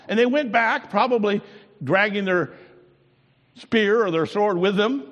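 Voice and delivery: high, loud preacher build; full-force preaching. Acted. Preacher telling the congregation about the guards, at full force. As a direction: drive the story home from the pulpit.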